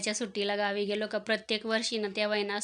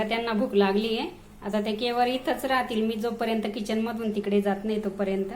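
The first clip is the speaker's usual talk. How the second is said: ordinary delivery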